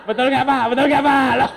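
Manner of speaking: very loud, high call through laughter; laughing shout. Real, not acted. Speaker demanding the husbands back him up, laughing shout. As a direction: holler for agreement, cracking up. Calls to husbands while cracking up.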